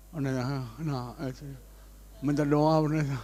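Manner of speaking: low, soft voice of a sick man; hushed retold plea; subdued confession. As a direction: imitate someone quietly asking for prayer. Voices sick man seeking prayer.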